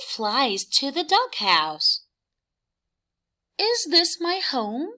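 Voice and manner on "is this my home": high, lifted bug voice with a rising question; light bug voice, curious; little bug asking. Acted. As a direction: ask it as the small lost bug. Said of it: "she asks".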